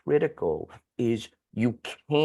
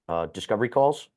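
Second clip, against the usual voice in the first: ordinary talk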